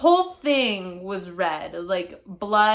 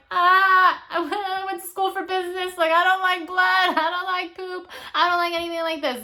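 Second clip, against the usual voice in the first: higher, lightly whiny protest; whiny self quote; 8 semitones higher than usual. Retold or imitated. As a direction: pitch it up, a mock squeamish complaint. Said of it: "and I'm like".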